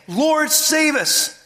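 acted desperate plea, slowed right down; mock panicked plea. Disciples waking Jesus in the storm, panicked plea.

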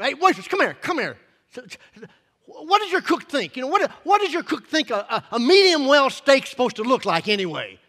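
loud, high, indignant complaining; upset diner. Retold or imitated. Diner complaining to the waitress about a raw steak. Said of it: "they're a little upset about their meal".

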